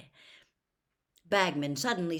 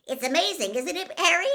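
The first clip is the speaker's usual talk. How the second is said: put-on much higher, louder breathless boy; excited gush; 12 semitones higher, 11 dB louder, faster than usual. Starstruck boy gushing at Harry, excited and high.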